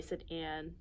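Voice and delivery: ordinary delivery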